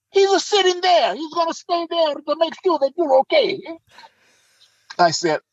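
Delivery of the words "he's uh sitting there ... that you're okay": put-on high-pitched Italian-accented English; imitated excitable Italian friend. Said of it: "he comes back, and he says".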